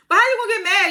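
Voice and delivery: high, loud disbelief; can't believe this guy; incredulous outburst. Real, not acted. Ranting about Kane's jealousy.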